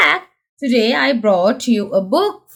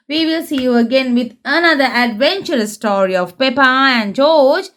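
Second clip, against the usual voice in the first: ordinary delivery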